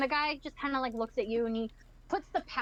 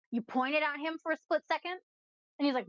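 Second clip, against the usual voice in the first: usual speaking voice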